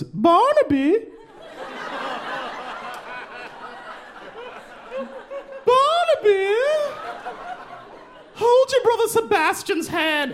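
very high sing-song call of "Barnaby"; posh mom calling. Retold or imitated. Brooklyn mom calls her son on the playground, very high. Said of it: "the mom goes".

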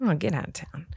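hushed, whispery incredulity; low, soft feigned amazement; mock disbelief. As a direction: playfully scoff under your breath. Mock disbelief at invented flavors.

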